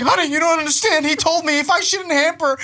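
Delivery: high, urgent husband's plea; mock earnest pleading. Husband explaining the hamper to his wife, pleading. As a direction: plead it like a deluded believer.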